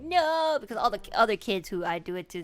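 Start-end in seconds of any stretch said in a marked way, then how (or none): 0.0-0.6 high, loud imitated kid's refusal; crying child's protest. Child refusing to have a wound cleaned, mock wail. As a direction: wail it like an upset child, mimicking. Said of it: "didn't want her to cry".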